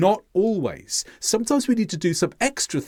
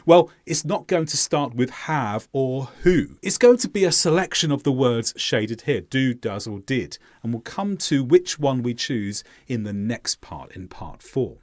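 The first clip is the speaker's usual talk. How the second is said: normal talking voice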